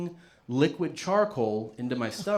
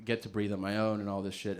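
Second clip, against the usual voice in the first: ordinary talk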